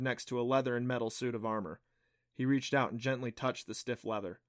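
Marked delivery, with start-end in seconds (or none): none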